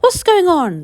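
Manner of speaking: high, loud startled cry; excited cry. Acted. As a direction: call out in amazement. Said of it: "they cried".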